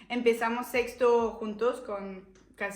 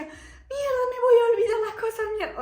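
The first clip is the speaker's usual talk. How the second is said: much higher, louder, fast panicked cry; mock panic; 10 semitones higher, 6 dB louder than usual. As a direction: freak out before the exam. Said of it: "después decía".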